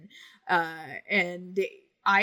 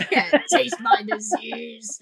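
laughing repeat; 2 semitones higher, 8 dB louder, slower than usual. Real, not acted. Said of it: "That needs to be on a t-shirt".

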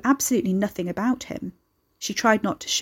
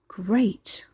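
whispered dry sarcasm; sarcastic whisper. Acted. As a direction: breathe it out, unimpressed. Sarcastic aside about creepy neighbour.

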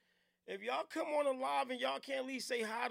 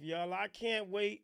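ordinary talk, nothing marked

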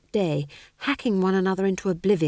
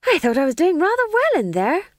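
put-on much higher, louder boyish voice; a pleased boy full of himself; eager young lad; 9 semitones higher, 6 dB louder than usual. A narrator's reading. Young Tom John voiced.

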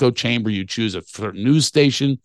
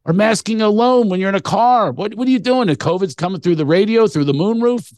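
mocking disbelief; 7 semitones higher than usual. Real, not acted. Mocking car maskers.

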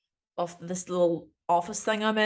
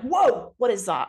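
sudden high loud whoa; mock startled whoa; 3 semitones higher, 6 dB louder than usual. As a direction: snap round at something shiny. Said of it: "and I'm like".

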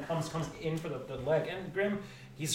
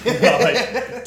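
ordinary talk

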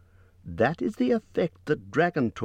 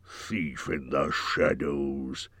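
lower, soft, slow gloat; gloating dragon; 7 semitones lower, 4 dB quieter, much slower than usual. A narrator's reading. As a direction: savour the insult quietly. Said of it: "he gloated".

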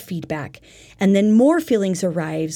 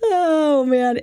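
usual speaking voice

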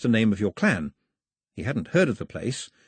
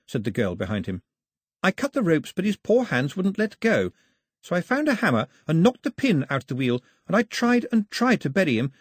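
all in one breath; 9 semitones higher than usual. A narrator's reading. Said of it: "all in one breath".

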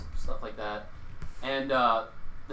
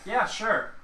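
high, louder strained retold agreement; forced agreeableness; 9 semitones higher, 5 dB louder than usual. Server placating a rude guest, forced agreeableness. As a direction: imitate an over-agreeable server, pitched way up.

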